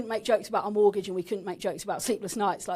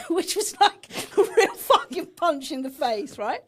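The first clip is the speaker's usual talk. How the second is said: bitter laugh through the words, higher and louder; slow, laughing disbelief; 9 semitones higher, 5 dB louder, slower than usual. Real, not acted. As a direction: laugh it off while it stings. Laughing at a painful memory.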